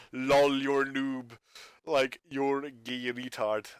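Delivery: slow, drawn-out dumb taunt on one note; monotone mock-troll jeer. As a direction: imitate a troll, dragging every word. Mimics trolls taunting people.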